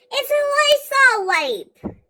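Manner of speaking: drawn-out laughing disbelief. Real, not acted. Granddaughter laughing about the Lysol wipe, slow laughing disbelief. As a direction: laugh through it, stretched slow.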